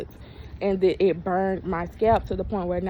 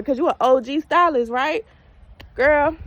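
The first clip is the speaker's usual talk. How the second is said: higher, louder sassy dig; sassy jab; 6 semitones higher, 5 dB louder than usual. Real, not acted. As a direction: call her out with attitude. Sarcastic jab at stylist.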